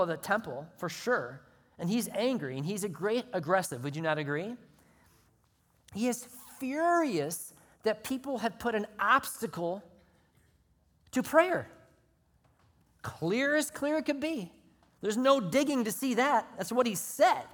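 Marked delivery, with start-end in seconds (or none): 5.9-17.4 much higher and louder, preaching hard; fiery preaching. Real, not acted. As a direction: build up and shout it out. Preacher building to a peak.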